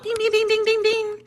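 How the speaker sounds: high chiming ding imitation; phone chimes. Nieces' group chat blowing up, high chiming dings. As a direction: mimic phone notifications going off fast.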